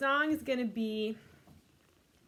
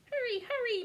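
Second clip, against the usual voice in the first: much higher excited mewing; mewing kittens; 12 semitones higher than usual. Acted. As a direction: squeak it like racing kittens. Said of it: "mewed the kittens".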